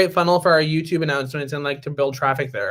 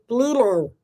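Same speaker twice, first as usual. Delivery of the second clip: Stitch voice; 5 semitones higher than usual. Retold or imitated. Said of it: "he talks like that".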